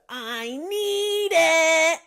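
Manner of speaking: slow, high drawn-out craving voice; SpongeBob impression. Host does SpongeBob line, slow high craving. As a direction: stretch it out, desperate. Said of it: "I do the".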